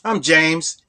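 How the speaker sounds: ordinary talk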